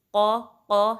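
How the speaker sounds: strong full-mouthed قا from back of tongue; heavy qaf. Shown as a demonstration. Teacher demonstrating qaf, loud heavy قا. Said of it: "heavy پڑھنا ہے".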